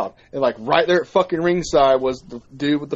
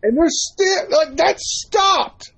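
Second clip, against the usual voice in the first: much higher, slow, stretched-out exasperated shout; exasperated outburst; 13 semitones higher, much slower than usual. Real, not acted. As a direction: throw up your hands in disbelief. Ranting about dropped storyline.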